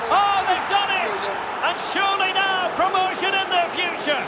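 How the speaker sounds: triumphant match call; excited goal call. Real, not acted. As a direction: hail the winning goal with soaring excitement. Commentator calls the deciding goal.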